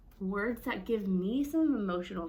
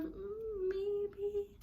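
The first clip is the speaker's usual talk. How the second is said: higher, soft, hesitant hum then maybe; unsure; 9 semitones higher, 3 dB quieter than usual. Shown as a demonstration. Teacher showing an unsure reading, hesitant. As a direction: hedge it like you're not sure. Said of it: "something that they're unsure of".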